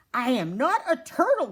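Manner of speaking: indignant. A narrator's reading. Cork, just jabbed, tells off Fuzz, indignant. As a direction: protest in mild mock indignation. Cork protests being stuck.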